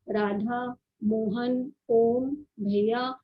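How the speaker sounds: राधा, मोहन, ओम, भैया each stretched out slowly; drawn-out calling words. Shown as a demonstration. Teacher showing students calling words, slow and stretched. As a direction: stretch each name like calling someone far away. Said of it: "किसी को पुकारने, बुलाने".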